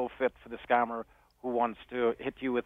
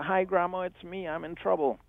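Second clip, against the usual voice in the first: crying voice; 7 semitones higher than usual. Retold or imitated. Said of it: "crying voice".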